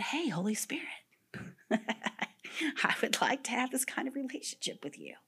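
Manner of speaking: soft, unvoiced whisper with a small laugh; whispered prayer. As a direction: breathe the request shyly.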